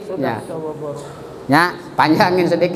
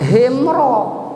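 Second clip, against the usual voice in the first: ordinary delivery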